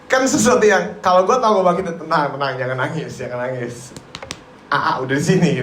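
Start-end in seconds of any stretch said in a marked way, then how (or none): 2.0-5.6 softer, lower hushing reassurance; calm them down gently, lower; soothing comfort. Pretend. Jesus hushing the weeping sisters, soft and soothing.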